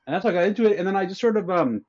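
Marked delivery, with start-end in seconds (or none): none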